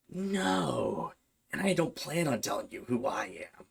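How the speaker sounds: soft, eerie possessed voice; a cold voice that isn't hers; demon voice. Retold or imitated. Demon in Bailey refusing to give its name, in a soft eerie voice.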